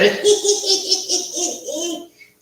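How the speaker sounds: high rising ghoulish cackle; Crypt Keeper cackle. Pretend. Crypt Keeper cackling at his audience. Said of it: "the Crypt Keeper".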